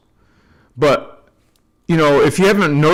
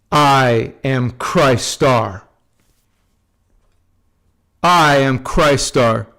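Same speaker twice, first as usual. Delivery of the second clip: slow, lower, deliberate mantra; slow affirmation; 3 semitones lower, slower than usual. Shown as a demonstration. Leading viewers in repeating affirmations, slow and deliberate. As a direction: lead the group through each affirmation slowly. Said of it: "Say it with me".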